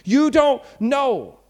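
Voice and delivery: You don't know, drawn out and slowed; slow emphatic protest. Acted. Teen protests to Jesus, slow and pointed. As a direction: stretch out each word of You don't know. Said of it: "Like, Jesus, it's easy for you".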